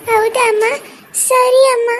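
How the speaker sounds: put-on high, small child voice; obedient sad daughter. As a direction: give in to mother like a sad little girl. Child daughter answering her mother. (ಅಮ್ಮನಿಗೆ ಒಪ್ಪುವ ಪುಟ್ಟ ಮಗಳ ಧ್ವನಿ)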